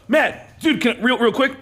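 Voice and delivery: high, eager, rushed asking; nervous starstruck plea. Retold or imitated. Fan asking the quarterback for a photo, high and eager. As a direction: beg quickly before he leaves.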